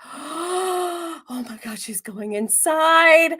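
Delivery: slow, drawn-out gasp of disbelief; gasping shock. Retold or imitated. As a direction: stretch it out like you can't believe your eyes.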